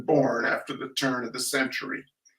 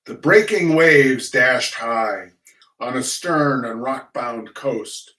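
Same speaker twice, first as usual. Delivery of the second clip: slow, louder grand opening line; poem recitation; 4 dB louder, slower than usual. Acted. Reciting The Landing of the Pilgrim Fathers, bold declamation. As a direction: declaim the first line boldly.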